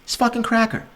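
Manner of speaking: hammed-up repeat of "This fucking cracker". Repeats quote higher.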